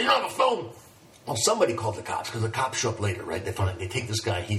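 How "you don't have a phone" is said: incredulous. Retold or imitated. Bleeding man's disbelief retold.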